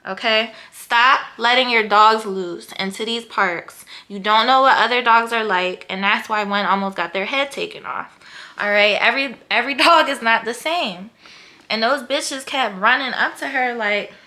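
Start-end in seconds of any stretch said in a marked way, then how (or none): 0.1-11.1 loud, heated lecture to careless dog owners; heated rant. Real, not acted. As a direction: tell them off, fired up.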